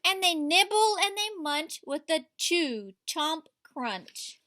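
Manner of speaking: lively read-aloud to a class; story reading to children. A narrator's reading. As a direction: read it like a picture book at story time. Teacher reads picture book aloud.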